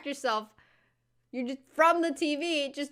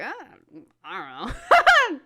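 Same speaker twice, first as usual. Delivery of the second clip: imitating mom; 5 semitones higher, 7 dB louder, slower than usual. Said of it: "she was like".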